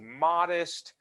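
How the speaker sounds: "modest" slowed and lifted high; stress on modest. Real, not acted. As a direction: lean hard on "modest", drawn out. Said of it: "I emphasize that word modest".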